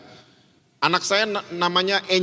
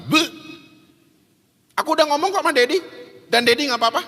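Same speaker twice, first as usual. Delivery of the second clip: put-on higher, fast, smug little boy; cheeky confident kid; 10 semitones higher, faster than usual. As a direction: stick out your tongue and brag. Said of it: "dengan pedenya dia keluarin lidah". (anak kecil pede membalas kakaknya, cepat dan tinggi)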